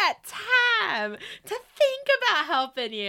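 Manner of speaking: high, slow sassy brush-off; sassy snap. Retold or imitated. Snapping at brother about helping, slow sassy. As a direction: drag it out with attitude. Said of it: "I'm like".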